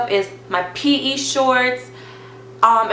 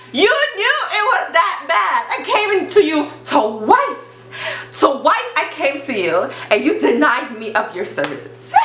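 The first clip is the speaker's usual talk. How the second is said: indignant outburst; 5 semitones higher than usual. Retold or imitated. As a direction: throw the accusation back at the nurse. Indignant retort aimed at nurse.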